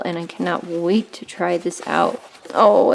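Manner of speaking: usual speaking voice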